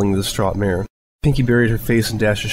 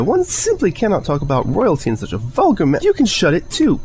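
high, rising, huffy shout; prim offended yell; indignant outburst; 9 semitones higher than usual. Acted. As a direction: cut someone off haughtily. Friends quarrel, yelling.

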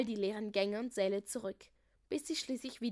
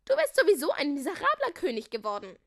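put-on higher, loud girlish jeer; loud taunt; 5 semitones higher, 10 dB louder than usual. A narrator's reading. Melissa taunting deposed Leo, high loud jeer.

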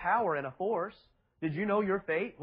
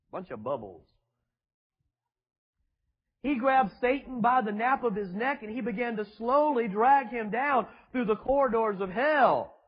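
normal talking voice

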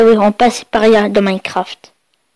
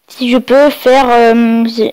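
ordinary talk, nothing marked

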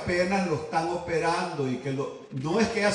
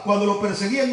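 ordinary delivery

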